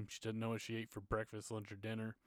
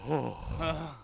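a hint of a stunned, higher "Oh"; stunned realization; 5 semitones higher, 5 dB louder than usual. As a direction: caught off guard, mild mock gasp of realization. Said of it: "I'm just sitting here going".

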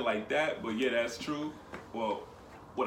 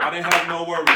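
shouting; 7 semitones higher, 12 dB louder than usual. Real, not acted. Loud triumphant shout.

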